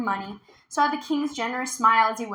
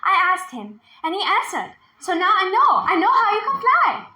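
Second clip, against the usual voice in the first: higher, louder, bubbling excitement; excited news; 7 semitones higher, 5 dB louder than usual. A narrator's reading. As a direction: tell the dragon gleefully. Girl shares happy news.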